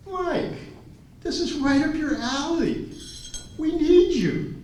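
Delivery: high-pitched acted appeal; urgent coaxing, replayed for laughs; retold pleading. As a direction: plead your case, pitched well up. Retold pleading with reluctant Mike.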